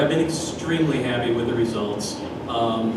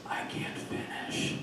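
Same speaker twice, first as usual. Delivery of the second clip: very soft, voiceless admission; acted exhausted whisper; 17 dB quieter than usual. Worn-out mall Santa telling the head elf, whispered. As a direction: give up in a spent whisper.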